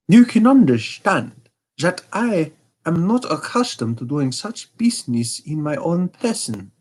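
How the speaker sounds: a hint of a haughty German king; Bohemian king. King speaks.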